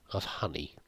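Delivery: a soft whispered aside. Real, not acted. Player showing off the barrel, whispered aside. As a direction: drop to a whisper for the last words.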